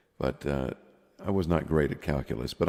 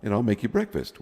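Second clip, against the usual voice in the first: higher-pitched, lightly voiced mom; friend's mom; 6 semitones higher than usual. Friend's mom offering breakfast to boys, lightly imitated mother. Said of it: "but his mom said".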